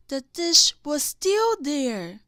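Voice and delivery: ordinary delivery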